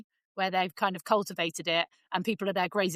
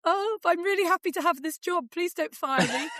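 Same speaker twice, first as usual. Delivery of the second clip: mock timid employee; 8 semitones higher than usual. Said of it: "mocking S0's inner thoughts".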